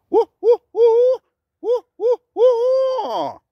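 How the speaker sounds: high, loud hoots with a long falling last note; flowing female owl hoot. Shown as a demonstration. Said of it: "female has a very nice flowing sound".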